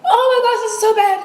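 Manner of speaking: high, loud, overwhelmed by spice; pained shout. Real, not acted. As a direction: cry out from the burning heat. Overwhelmed by spicy heat.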